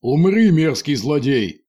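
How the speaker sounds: loud, slow heroic shout; battle cry. A narrator's reading. Prince yells at the villain, slow loud heroic shout. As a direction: charge with a hammed-up yell. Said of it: "завопил и принц".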